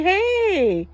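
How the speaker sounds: high, loud hello back; cheerful greeting. Retold or imitated. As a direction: answer the greeting brightly and high.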